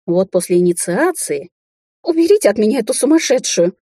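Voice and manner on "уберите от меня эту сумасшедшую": high shrieking plea for help; panicked screech. A narrator's reading. She-wolf struggles away from witch, screeching. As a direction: squeal it out in a panic. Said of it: "заверещала волчица".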